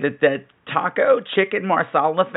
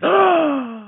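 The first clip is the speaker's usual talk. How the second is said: excited gasp; 13 semitones higher than usual. Retold or imitated.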